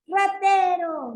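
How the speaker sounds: loud, high, drawn-out alarm shout. Acted. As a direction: shout after a thief, as a demonstration. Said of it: "¿Cómo levantamos la voz?".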